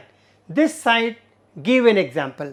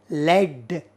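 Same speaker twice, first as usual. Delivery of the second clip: ordinary delivery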